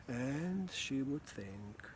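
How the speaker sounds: drawn-out, unhurried words; slow thinking. Retold or imitated. Coach mimics their slow-thinking partner, drawn-out words. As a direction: stretch it out like someone slow to answer. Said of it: "And for her".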